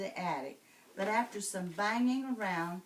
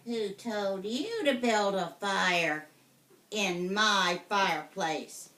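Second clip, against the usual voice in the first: loud, slow witch voice; witch shouting; 7 dB louder than usual. Acted. Witch scolding the boy, loud and slow. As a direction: demand it like an angry old witch. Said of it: "she shouted".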